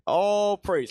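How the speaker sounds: ordinary talk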